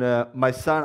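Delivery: ordinary talk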